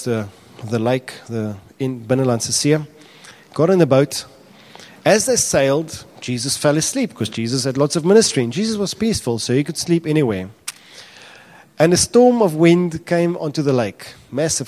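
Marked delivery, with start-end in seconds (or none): none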